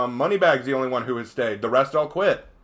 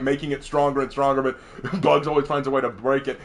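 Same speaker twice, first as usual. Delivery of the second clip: usual speaking voice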